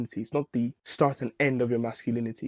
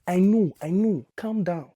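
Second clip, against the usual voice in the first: mock soothing; 5 semitones higher, slower than usual. Mock soothing imagined critic.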